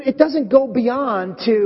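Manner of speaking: ordinary talk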